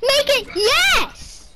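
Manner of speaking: slow, loud, high victory yell; excited cheer. Real, not acted. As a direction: drag out the cheer. Cheering a shot.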